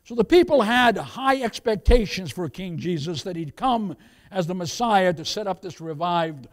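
forceful, emphatic sermon delivery; preaching. Real, not acted. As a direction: preach it out to the congregation, punching key words. Preaches to congregation.

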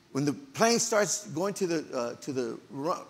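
ordinary delivery

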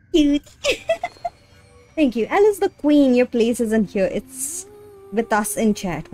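ordinary talk

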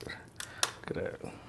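hushed, awed whisper. Real, not acted. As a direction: breathe it out in awe. Breaks chicken apart, awed.

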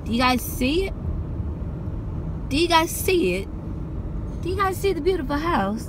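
posh London voice, hammed up; London accent. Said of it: "I need to work on my London accent".

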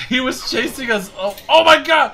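high, loud relieved yell; excited shout. Real, not acted. Shouting after the chase.

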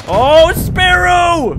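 very high, slow yell; loud drawn-out cry. Real, not acted.

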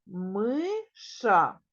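Миша split into two clear syllables; syllables spelled out. Shown as a demonstration. Teacher shows children the syllables, slow and clear. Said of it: "В цьому слові два склади".

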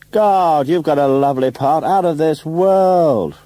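high, loud, drawn-out stage declamation; theatrical shout. A narrator's reading. Theatrical man praising an actor's part, shouted grandly. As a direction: proclaim it like a posing thespian. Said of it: "part of the theatrical pose".

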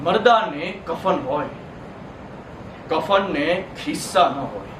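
slow, low, weighted delivery; slow and sombre, with long pauses; grim pronouncement. Acted. Bitter punchline about the dead audience.

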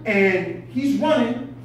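usual speaking voice

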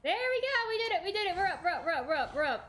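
high, excited celebration; triumphant cheer. Real, not acted. Streamer finally clears the hard climb, high excited cheering. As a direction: cheer it out, thrilled to be up.

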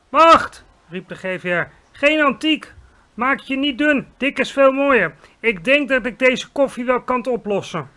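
loud, high giant's call. A narrator's reading. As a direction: burst in eagerly. (luid en hoog roepend)

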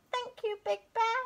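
high small voice of a scared cub; high-pitched timid bear cub; little bear cub. A narrator's reading.

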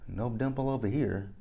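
ordinary talk

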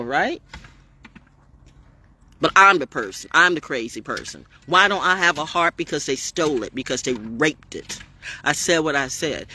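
usual speaking voice

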